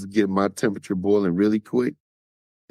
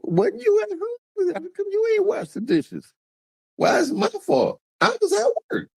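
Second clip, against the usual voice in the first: mock falsetto. Partner mocked answering about dishes, very high falsetto. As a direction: squeal it like a cartoon.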